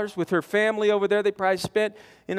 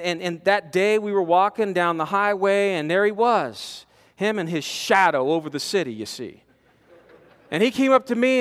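ordinary talk

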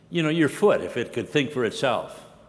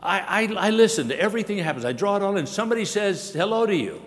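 higher, quick bragging voice; boastful ear; 6 semitones higher than usual. Retold or imitated. Preacher voicing the ear boasting to the body, raised pitch. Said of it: "the ear can say".

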